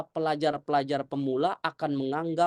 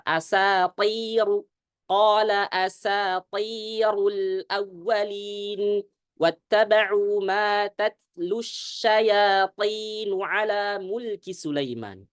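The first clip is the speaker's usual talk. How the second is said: very slow, measured Quranic recitation; recitation demo; 3 semitones higher, 3 dB louder, much slower than usual. Shown as a demonstration. Ustadz reciting the verse correctly for the congregation, slow chant. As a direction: chant the verse slowly and evenly. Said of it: "Jadi membaca".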